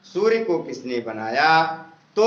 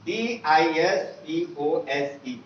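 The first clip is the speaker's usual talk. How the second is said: ordinary talk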